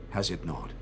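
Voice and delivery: ordinary talk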